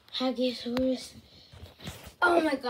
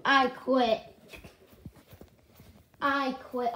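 ordinary delivery

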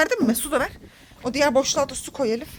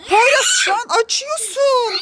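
laughing scold; 10 semitones higher, slower than usual. Real, not acted. Mother scolds son spraying water, laughing high. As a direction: scold him through a laugh.

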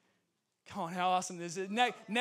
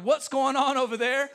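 louder, a bit higher, calling across the lot; puzzled call; 4 semitones higher, 11 dB louder than usual. Retold or imitated. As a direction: shout it from a passing car. Passing drivers call out, puzzled.

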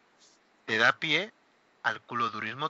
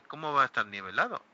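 ordinary delivery

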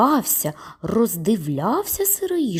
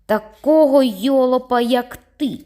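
high, loud angry snap back; angry retort; 6 semitones higher, 5 dB louder than usual. A narrator's reading. Boy snaps back at the bully, angry and loud. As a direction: fling the insult back in anger. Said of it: "з пересердя сказав Сергій".

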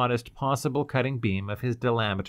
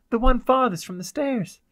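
high, loud, prompt answer; girl's clear voice; 13 semitones higher, 5 dB louder than usual. Acted. The girl gives Cloud directions, clear urgent voice. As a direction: answer clearly in a raised pitch.

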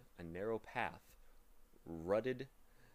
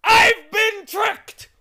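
very high, slow outraged shout; helpless cry; 25 dB louder, much slower than usual. Acted. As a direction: howl it like a cheated wizard. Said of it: "cried the math magician helplessly".

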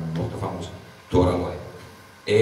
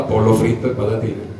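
normal talking voice

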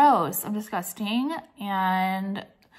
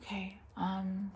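whisper; 11 dB quieter, slower than usual. Real, not acted. Whispering before recording starts.

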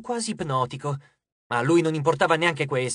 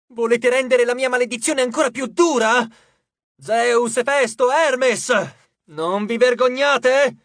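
high, loud tirade at the gods; shouted reproach; 6 semitones higher, 7 dB louder, slower than usual. A narrator's reading. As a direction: scold the heavens at a shout. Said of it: "urlò alla volta Celeste". (rimprovero urlato)

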